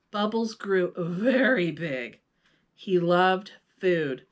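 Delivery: usual speaking voice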